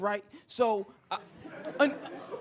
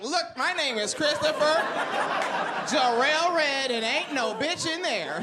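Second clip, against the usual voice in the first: slow, higher-pitched self-identification; earnest insistence; 5 semitones higher than usual. Acted. Teen proving his name to the stranger with ID, slow earnest insistence. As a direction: spell it out slowly, as if showing ID. Pretends to show ID as proof.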